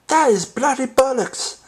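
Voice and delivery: very high, exaggerated British accent; high mock British accent for fun. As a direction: ham up a cheeky British accent. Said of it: "I love it when they say bloody".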